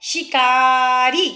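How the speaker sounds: शिकारी stretched very slowly, शि का री each held; split into syllables. Shown as a demonstration. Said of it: "तोड़ के बोला है".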